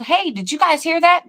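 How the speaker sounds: high-pitched mock call-out; retold yell. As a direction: call to the group without much volume. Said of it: "and I yelled".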